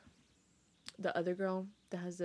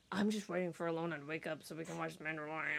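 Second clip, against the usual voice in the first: grumpy; 4 semitones lower, 7 dB quieter, faster than usual. Retold or imitated. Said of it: "I did not sound that grumpy".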